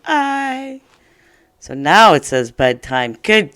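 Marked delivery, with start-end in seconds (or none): 0.0-0.8 I sung out high and long. Shown as a demonstration. Teacher showing how the bossy E makes I say its name. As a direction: ring out the letter name I high. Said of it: "make that I say its name".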